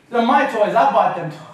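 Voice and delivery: loud, emphatic mom retort; mock scolding. Mother claiming the toys from her runaway son, mock scolding.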